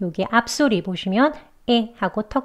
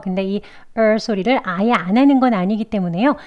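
normal talking voice

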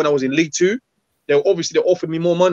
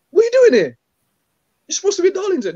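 much higher, loud astonished question; shocked manager; 14 semitones higher, 3 dB louder, faster than usual. Retold or imitated. Manager finding player back from loan, much higher shocked cry. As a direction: squeal it like the boss can't believe his eyes.

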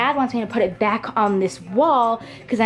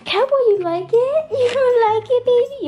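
high, loud sing-song pet voice; 14 semitones higher, 5 dB louder than usual. Real, not acted. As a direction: coo at the puppy. Talking to her dog.

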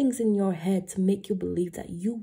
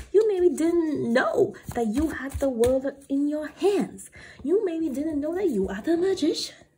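rising empowerment; 7 semitones higher than usual. Acted. Empowering build in tarot reading.

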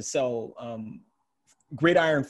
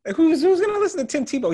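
high, loud and disbelieving; incredulous; 14 semitones higher, 6 dB louder than usual. Real, not acted. Host mocking the idea of Tebow as mentor, incredulous. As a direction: scoff at the very idea, pitched way up.

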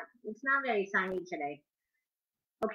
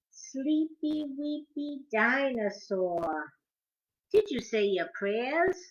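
bedtime story reading; 3 dB louder, slower than usual. Acted. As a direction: lull the kids toward sleep. Reading picture book to children.

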